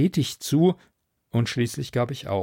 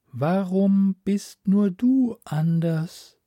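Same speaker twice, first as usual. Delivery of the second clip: slow, higher, otherworldly voice; drawn-out alien calm; alien sea voice; 7 semitones higher, slower than usual. Acted. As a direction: speak as a vast ocean mind, unhurried. Alien sea mind speaks.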